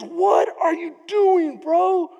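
loud, high, slow shouted call; hammed-up shout of a brash prince; mock shout. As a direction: yell it across the field. Absalom shouting at Joab's servant.

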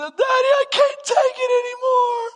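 put-on high, loud wailing little boy; father voicing his sobbing son; imitating a crying child. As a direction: cry it out like a desperate kid. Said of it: "he just starts crying".

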